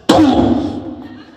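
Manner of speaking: cannon boom. Retold or imitated. Comedian imitating the cannon shot for the audience, as a loud boom. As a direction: shout boom like the cannon going off.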